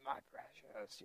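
very quiet, low creature rasp; badly faked creature voice, barely voiced; Gollum. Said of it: "I can't do a good Gollum accent".

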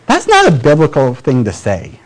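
ordinary delivery

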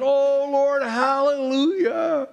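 loud, high worship cry, drawn out; mock a fervent hallelujah, stretched long; fervent worshipper. Preacher mimics Christians worshipping God, fervent and mocking.

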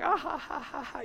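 helpless laughter, acted out softly; mock breathless "ha-ha-ha" giggling; imitated hard laughing. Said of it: "you know, laughing so hard".

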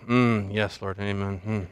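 very low, hushed murmurs of assent; approving prayer group. Acted. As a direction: imitate pious mumbled agreement. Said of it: "people in the prayer group go".

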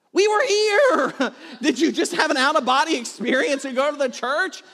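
hammed-up parental outburst; incredulous dad. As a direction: cry out in comic disbelief. Incredulous dad retelling argument with son.

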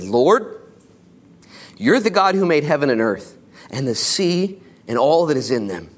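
ordinary talk

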